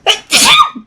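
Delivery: a sudden loud sneeze; sneezing. Real, not acted. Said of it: "I'm gonna sneeze".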